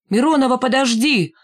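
high-pitched, drawn call; calling after someone. A narrator's reading. Лохматый calls after departing Миронова, calling out. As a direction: call out to stop her leaving. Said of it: "крикнул ей вслед Лохматый".

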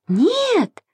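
high, drawn-out protest; crying out. A narrator's reading. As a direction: cry out a refusal, voice shooting up. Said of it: "закричала Маша". (протестующий крик высоким голосом)

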